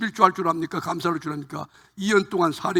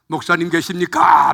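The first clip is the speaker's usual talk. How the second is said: louder call at the door; 9 dB louder than usual. Retold or imitated. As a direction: shout to the house from the doorway. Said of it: "막 소리쳐".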